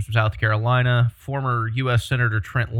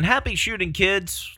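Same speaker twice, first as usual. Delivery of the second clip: sarcastic echo; 8 semitones higher than usual. Retold or imitated. Sarcastically echoing the clip's sign-off.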